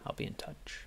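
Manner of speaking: whispered threat, barely any voice; menacing whisper. Acted. Fey gentleman leaving Jennings with a whispered threat. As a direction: breathe the promise like a secret threat.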